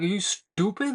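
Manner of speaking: retold exasperated challenge; incredulous retort. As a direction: lightly act out disbelief at a dumb idea. Acted retort to a risky plan.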